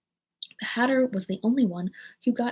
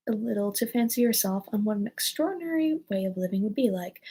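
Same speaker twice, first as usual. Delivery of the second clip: ordinary delivery, nothing marked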